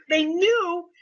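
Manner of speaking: ordinary talk, nothing marked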